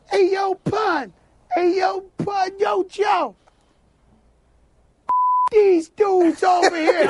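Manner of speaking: high-pitched little voice, drawn out. Retold or imitated. Little voice calls out to [name] out of nowhere, high-pitched imitation. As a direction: call out in a small, high voice. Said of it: "you hear one little voice out of nowhere go".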